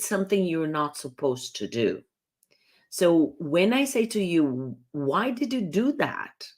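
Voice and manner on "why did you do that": pointed, higher demanding question; challenging why. Shown as a demonstration. Coach showing how a why question challenges the listener, accusing. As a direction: demand an explanation with an accusing edge. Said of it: "I'm challenging you".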